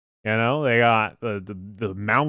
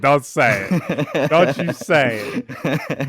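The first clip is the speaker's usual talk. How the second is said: drawn-out pleading; 2 semitones higher, much slower than usual. Real, not acted. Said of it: "begging friend not to say it".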